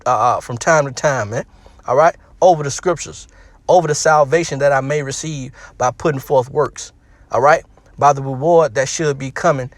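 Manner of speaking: ordinary delivery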